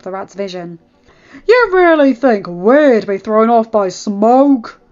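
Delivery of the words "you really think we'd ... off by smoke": loud, slow, scornful growl. Acted. Derek scorning the smoke cloud, growling. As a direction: growl it as a challenge. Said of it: "Derek growled".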